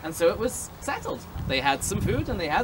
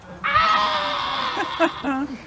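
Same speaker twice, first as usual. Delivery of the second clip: burst of laughter. Real, not acted. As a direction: crack up at your own joke.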